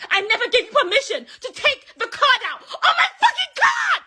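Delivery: very high, loud screaming rant; furious laughing shout. Real, not acted. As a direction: scream it at him, half laughing in disbelief. Furious ranting at ex.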